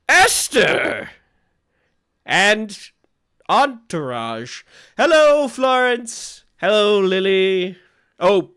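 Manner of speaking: put-on high, loud drunken welcome; tipsy host greeting. Tipsy Lynn greeting Esther at the door.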